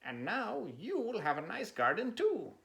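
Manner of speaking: Frog's voice, pleased. Acted. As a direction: Frog happily congratulating. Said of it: "said Frog".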